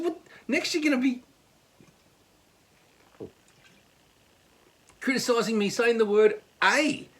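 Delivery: high-pitched, exasperated comeback; incredulous retort. Retold or imitated. Retorting to criticism of a word, mock exasperated. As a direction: throw it back in high-pitched disbelief. Acted sarcastic retort in the quarrel.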